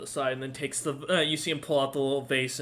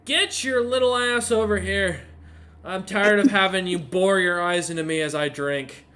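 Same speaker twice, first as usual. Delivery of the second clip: put-on loud, higher drunk bellow across the room; calling out; 5 semitones higher, 9 dB louder than usual. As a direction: yell her over, half scolding. Drunk father calls daughter over.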